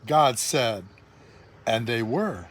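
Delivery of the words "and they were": usual speaking voice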